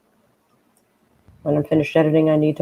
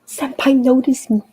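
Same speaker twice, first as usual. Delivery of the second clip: high, giddy fangirl squeal; excited anime fan; 10 semitones higher, 3 dB louder than usual. Pretend. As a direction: gasp and swoon over senpai. Reading fan chat in a put-on voice.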